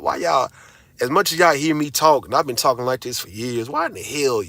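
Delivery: usual speaking voice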